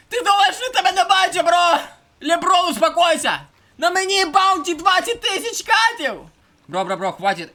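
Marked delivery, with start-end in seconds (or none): 0.1-6.2 high, loud pleading yell; panicked shouting. Real, not acted. Streamer shouting at his fleeing character in alarm. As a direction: yell at him to calm down.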